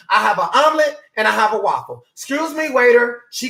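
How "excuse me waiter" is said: calling waiter. Retold or imitated. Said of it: "calling waiter".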